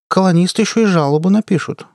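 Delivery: careless toss. A narrator's reading. Crewmate adds colonists will complain, offhand. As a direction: toss it out casually. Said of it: "небрежно бросила Ксифая".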